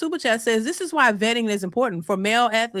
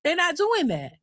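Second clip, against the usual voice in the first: usual speaking voice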